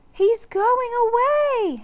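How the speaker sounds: put-on breathless girl at the window; panting girl. A narrator's reading. Annie watching the T. rex leave, breathless and high. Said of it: "she said, panting".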